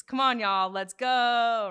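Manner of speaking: imitated workout soundbite. Interviewer quotes the trainer's famous catchphrase, imitated rallying shout. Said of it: "you have a very famous soundbite".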